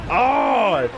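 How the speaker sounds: sudden high "Oh!" at a big move; surprised shout. Real, not acted. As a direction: gasp out a startled oh. Big move lands in match.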